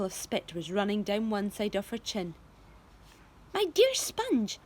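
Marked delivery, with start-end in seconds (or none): 3.5-4.6 high, slow sly drawl. A narrator's reading. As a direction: draw it out scheming. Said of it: "Aunt Spiker said slowly".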